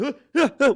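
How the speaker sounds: exaggerated huffing grunts; loud rhythmic grunts; mock grunting. Mimicking a businessman grunting.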